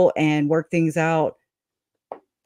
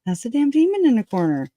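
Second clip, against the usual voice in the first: pitched up, faster, punchy; the demon line, redone higher; higher emphatic repeat; 7 semitones higher, faster than usual. Shown as a demonstration. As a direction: say it again higher and quicker.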